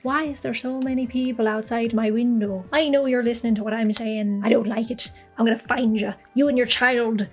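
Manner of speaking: put-on Irish lilt; Irish accent. Griping at people outside the window, mock Irish accent. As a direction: do a playful, exaggerated Irish accent. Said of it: "I can totally do an Irish accent".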